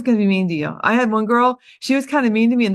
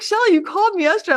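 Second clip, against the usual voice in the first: amused prospect voice; 10 semitones higher, faster than usual. Retold or imitated. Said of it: "She's like".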